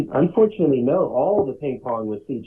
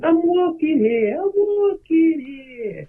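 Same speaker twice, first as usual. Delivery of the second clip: much higher, slow drawn-out mock yell; cartoon character yelling; much slower than usual. Rick and Morty character running around, high slow mock yell. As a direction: stretch it out like a cartoon. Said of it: "a character running around going".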